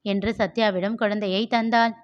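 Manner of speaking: ordinary delivery, nothing marked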